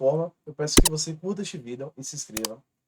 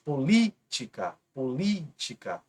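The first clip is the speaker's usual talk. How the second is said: "política" slow, stress on "lí"; stressed syllable shown; slower than usual. Shown as a demonstration. Teacher to students, "política" drawn out on "lí". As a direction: dwell on "lí" in "política". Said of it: "Antepenúltima mais forte".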